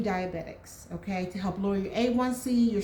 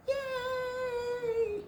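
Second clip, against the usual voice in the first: high, drawn-out "yay" held on one note; excited cheer; 6 dB quieter than usual. Real, not acted. Vlogger cheering her Ozempic update, excited. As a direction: let out a long, soft, high-pitched squeal of yay. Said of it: "so excited".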